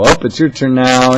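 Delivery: cheerfully palming a caller off; mock handoff. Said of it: "just pass it and go".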